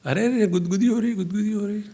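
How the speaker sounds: quick, high ticklish protest; ticklish water. Acted. Water to the fish moving in it, ticklish.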